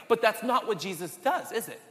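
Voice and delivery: normal talking voice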